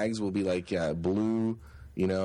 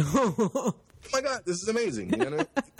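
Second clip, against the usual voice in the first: mock excitement; 13 semitones higher than usual. Finding a snake bite kit in Texas, very high and thrilled. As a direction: gush over the amazing find.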